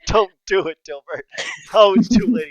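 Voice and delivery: loud, high laughing plea; laughing shout. Real, not acted. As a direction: yell it between laughs. GM yelling warning at player.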